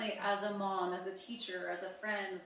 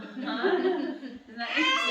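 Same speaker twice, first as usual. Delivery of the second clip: slow, louder, laughing amazement; laughing wonder; 4 semitones higher, 9 dB louder, much slower than usual. Real, not acted. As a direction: marvel at it through a laugh. Amused drawn-out wonder.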